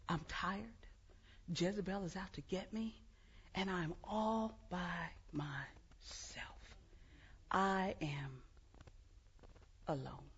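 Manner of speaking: an exhausted prophet barely breathing the words; weary whisper. Acted. Elijah voiced, exhausted and alone.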